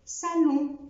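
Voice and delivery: "سالون" ending in a nasal o, high. Shown as a demonstration. Teacher modelling salon. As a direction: drop the n into the nose. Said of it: "الإكزامبل التاني سالون".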